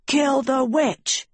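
high, slow mob cry; battle cry. A narrator's reading. Attackers storming the stairs after the witch, crying out. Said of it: "Evers cried".

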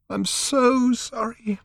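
high, slow tearful apology; sobbing girl apologising. A narrator's reading. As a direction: choke it out through tears.

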